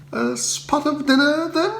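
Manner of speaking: high, bright upper-class chirp; cheery Bertie. Acted. Bertie greeting Spode at the door, high and breezy. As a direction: greet him breezily, unbothered. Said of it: "I retorted".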